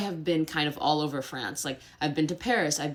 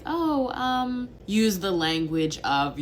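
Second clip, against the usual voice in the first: higher, hesitant softening before criticism; mock a polite American stalling; 5 semitones higher, 3 dB louder, much slower than usual. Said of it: "kind of be like".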